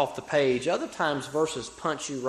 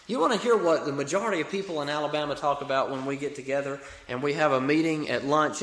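ordinary delivery

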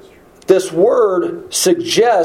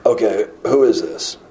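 hushed, lower, awed voice; spooked awe; 8 semitones lower than usual. Retold or imitated. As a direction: murmur it like an unnerved disciple. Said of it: "And then they're spooked".

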